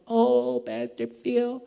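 high, drawn-out little lady voice; sweet little lady. Retold or imitated. Troubled woman greeting her pastor, high sweet voice. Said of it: "the sweet little lady's voice".

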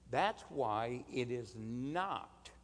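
ordinary talk

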